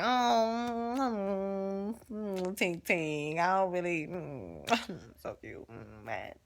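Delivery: swooning Kara. Retold or imitated. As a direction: play Kara melting over him, barely voicing the words. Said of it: "I don't know if it was like".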